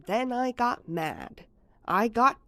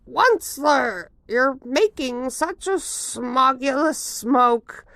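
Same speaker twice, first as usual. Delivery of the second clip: high, loud scolding creature; Lorax voice; 6 semitones higher, 5 dB louder than usual. Acted. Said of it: "with a crofulous croak".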